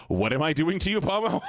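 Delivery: high, incredulous question climbing to the end; mock alarm. Real, not acted. Streamer questions Pomo's naughty message in chat, mock alarm. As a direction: pitch rising in playful disbelief.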